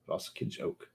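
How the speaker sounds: Voskage oak whispered under the breath; whispered try. Shown as a demonstration. Rehearsing the name to himself in a whisper. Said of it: "Vos-, Voskage".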